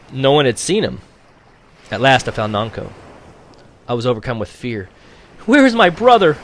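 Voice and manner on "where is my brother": much higher, louder panicked cry; frightened cry. A narrator's reading. Girl on the ship asking Nanko for her lost brother, crying out in fear. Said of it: "I cried".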